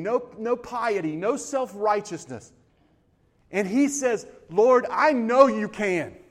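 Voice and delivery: loud, slow, impassioned preaching, the father's cry rising; full-force preaching. Acted. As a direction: preach it out, pleading for the whole room.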